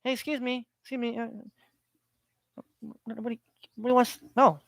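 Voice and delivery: soft, hesitant politeness; mock timid, barely speaking up; trying to be nice. Politely trying to get somebody's attention, hushed and meek. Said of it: "trying to be nice".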